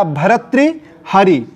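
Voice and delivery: भर्तृहरि slowly, broken before हरि; slow bharat-tri, then hari in भर्तृहरि; careful correct form. Shown as a demonstration. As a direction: spell out भर्तृहरि by syllables, slowly. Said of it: "ध्यान दीजिएगा".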